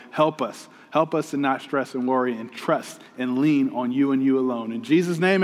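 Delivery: low, soft, earnest prayer; opening prayer. Real, not acted. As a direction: pray gently on behalf of the congregation.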